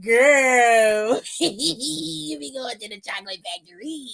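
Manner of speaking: laughing through the teasing; cracking up. Real, not acted. Host teasing Lash over her pet name, cracking up.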